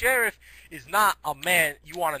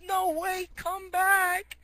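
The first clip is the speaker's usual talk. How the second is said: high-pitched, pleading call; mock desperate call; 13 semitones higher, much slower than usual. Hero calling after the fleeing raccoon, high mock-desperate plea. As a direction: call out high, in comic dismay.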